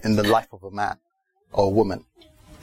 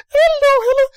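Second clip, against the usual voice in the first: very high, loud squeak; squeaky voice; 8 dB louder than usual. Acted. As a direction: put on a comically squeaky little voice. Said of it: "my voice is just a bit squeaky".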